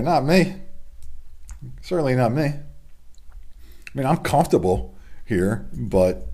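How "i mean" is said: usual speaking voice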